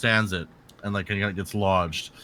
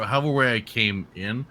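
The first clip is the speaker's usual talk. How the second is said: ordinary talk, nothing marked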